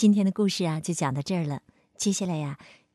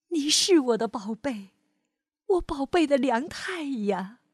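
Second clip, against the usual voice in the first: put-on gentle storyteller reading to a child; lively read-aloud for kids; expressive bedtime-story voice; story narration; 5 semitones higher than usual. A narrator's reading.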